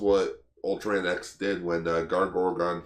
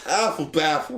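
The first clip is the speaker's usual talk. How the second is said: mock shout; 8 semitones higher than usual. Repeating funny word.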